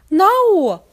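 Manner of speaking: high, loud desperate cry; desperate shout. Acted. Apple Bloom crying out to stop her sister.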